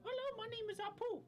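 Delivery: high, loud put-on Indian shopkeeper accent; Apu impression. In an Indian restaurant, greeting staff as Apu, loud mock Indian accent. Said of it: "I feel like I gotta do this".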